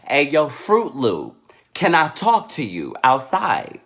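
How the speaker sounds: loud, emphatic summons; confrontational mother. Retold or imitated. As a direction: summon the teacher like a fed-up mom. Mother calling teacher out again.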